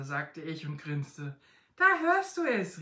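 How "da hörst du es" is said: high, loud exclamation; raised-voice outburst; calling out. A narrator's reading. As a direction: cry it out, pitched well up. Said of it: "rief der Mann".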